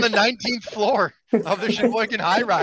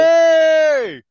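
calls out the name, high and loud; excited shout; 8 semitones higher, 7 dB louder than usual. Real, not acted. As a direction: cheer her arrival. Greeting Nelly on the call.

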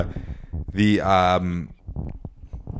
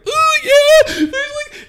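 panicked squeak; 9 dB louder than usual. Retold or imitated. Panicked admitting weed to cop.

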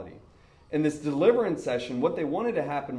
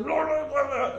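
much higher mock gibberish; possessed babble; 11 semitones higher than usual. Boy convulsing on the floor, mock babbling. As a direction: imitate someone babbling in convulsions.

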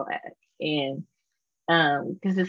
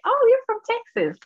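high, loud mock voice of a stranger; squeaky mock surprise, much higher; 5 dB louder, faster than usual. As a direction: pitch it way up, hammed-up. Imagined stranger quoted abroad.

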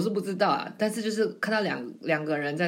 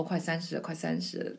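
焦虑地低声念叨；轻声、几乎耳语地重复；像心里嘀咕一样小声念；轻声嘀咕快三十了; 7 dB quieter than usual. Retold or imitated. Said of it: "不要再一直想说".

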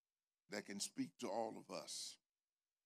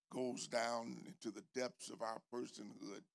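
usual speaking voice

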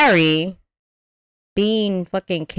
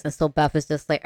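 usual speaking voice

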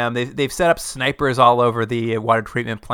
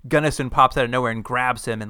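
normal talking voice

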